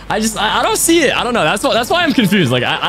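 ordinary delivery

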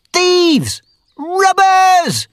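high, loud accusing yell; shouting. A narrator's reading. Miller yelling at the outlaws while beating them, loud and high. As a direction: cry out at the thieves. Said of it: "he shouted".

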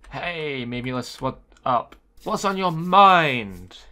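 slow, heavy bartender voice; slow, loud question. Acted. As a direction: stretch each word like a pushy shrink. Voicing the bar owner's line.

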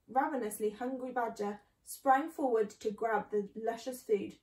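plain reading voice

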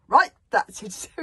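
loud outburst, slowed for finality; booming. A narrator's reading. Stoic has had enough of his bodyguard, booming. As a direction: boom it out, loud and deliberate. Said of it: "boomed Stoic the Vast".

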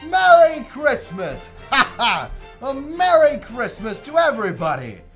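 loud, high, bursting with joy; joyful shout. Acted. Scrooge cries greetings to everybody in giddy joy. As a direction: shout it out to the whole street.

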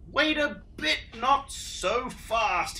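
fussy objection, slowing down; bristling Echidna. Acted. Echidna stops Dingo, high and prickly. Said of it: "he bristled".

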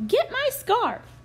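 high, loud bratty demand; yelling stepsister. A narrator's reading. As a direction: bark the order like a spoiled sister. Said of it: "yelled one sister".

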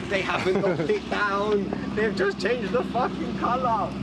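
can't believe it's still standing; excited disbelief. Real, not acted. Seeing his old bomb shelter shop again, excited and loud.

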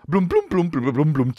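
high plunking "bloom" notes, falling away; vocal imitation of quirky scene music; mock TV music cue. Mocking Grey's Anatomy's music, plinky imitated cue.

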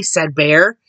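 usual speaking voice

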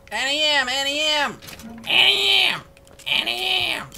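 high, wailing call; hammed-up plaintive film heroine impression; Wizard of Oz heroine. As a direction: cry out the name in a piercing high voice. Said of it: "That's how she sounded".

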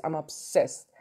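ordinary delivery

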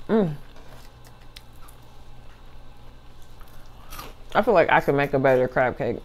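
mumbling between bites; chewing while talking. Real, not acted. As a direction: mouth partly full of food. Seen 3: Puts crab cake in mouth at -1 and 3.5; chews while speaking.

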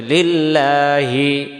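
লিল্লাহি chanted slowly, the লা held one alif long; drawn out. Shown as a demonstration. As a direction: stretch the লা in লিল্লাহি gently. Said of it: "মানে ধীরে করতে হবে".

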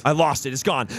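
ordinary talk

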